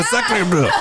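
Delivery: high French exclamation, laughing; French accent. Acted. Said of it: "do your best Frenchie".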